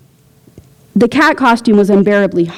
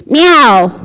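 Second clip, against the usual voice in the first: angry meow; 5 semitones higher, 5 dB louder than usual. A narrator's reading. Angry cat quarrel.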